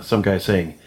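ordinary talk, nothing marked

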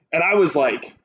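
ordinary delivery

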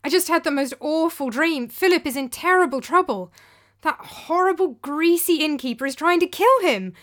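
loud, high, alarmed pleading; urgent cry. A narrator's reading. Demos waking in fright, urgently telling Aristo. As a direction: blurt it out in a rush of panic. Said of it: "I cried".

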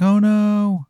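high mock dismay. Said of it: "He's like".

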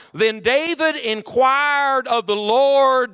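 slow it right down so nobody misses it; emphatic slow reading. Shown as a demonstration. Preacher to congregation, slowing right down to underline the verse. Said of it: "don't miss it".

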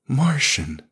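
scornful, high start falling. A narrator's reading. Alien girl scornfully rejecting the word Martian. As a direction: spit the word with disdain. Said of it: "she said scornfully".

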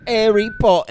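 high, exaggerated British "'Arry Po'ah"; hammed-up British mimicry; mock British accent. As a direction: drop the consonants like a cartoon Brit. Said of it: "everybody's just like".

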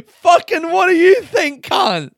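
loud, high, drawn-out mock outrage; hammed-up incredulous comeback; exasperated outburst. Camper asked what he needs, exasperated mock yell.

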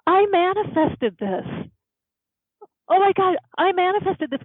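the truth hits her all at once; shocked realization. Real, not acted. Shocked realization of self-cause.

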